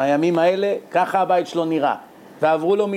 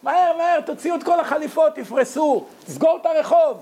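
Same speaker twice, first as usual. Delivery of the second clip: high frantic shouting at the staff; shouting seller; 12 semitones higher than usual. Acted. Shopkeeper yelling at his staff to hurry, high frantic shout. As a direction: bark orders to hurry, pitched way up. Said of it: "צועק".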